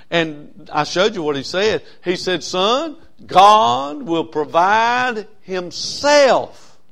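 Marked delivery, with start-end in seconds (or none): 2.4-6.8 very slow, higher and louder, each word drawn out; solemn father's promise. Acted. Abraham reassures his son on the mountain, slow and solemn. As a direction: lean in and stretch it like a prophecy.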